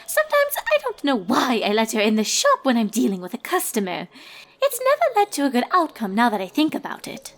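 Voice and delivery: high, prim, gossipy lady unicorn; a refined fashionista dishing gossip, pitched high; Rarity. Acted. Rarity's monologue continues.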